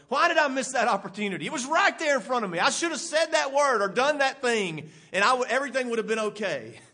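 loud, fast frustration at yourself; self-reproach. Retold or imitated. Scolding yourself over a missed opportunity, loud. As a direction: kick yourself for the missed chance. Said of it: "I've been in that way with myself".

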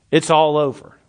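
ordinary talk

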